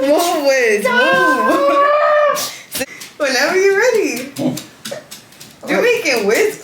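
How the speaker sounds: loud, high, laughing push to hurry; excited urging. Real, not acted. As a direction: egg them on loudly. Urging partner during gender reveal.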